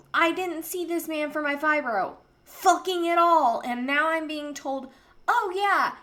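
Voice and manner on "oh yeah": mocking doctor.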